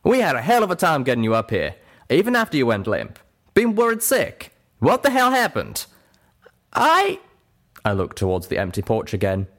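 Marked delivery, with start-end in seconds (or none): 0.0-5.8 high, exasperated character voice, building louder; rising worry into a demanding question; Calamity retorting. A narrator's reading. Worried friend questioning Little Pip after rescue, retorting. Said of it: "Calamity retorted". 6.7-7.2 very high, loud broken-off start; hesitant Little Pip. Acted. Little Pip caught wanting to lie, hesitant. Character hesitates before confessing.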